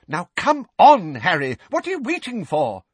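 put-on high, fussy old woman; flustered old neighbour, high and fretful. A narrator's reading. Mrs Figg voiced.

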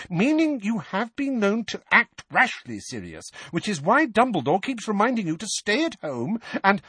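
high-pitched, insistent reproach; agitated motherly lecture; heated scolding. A narrator's reading.

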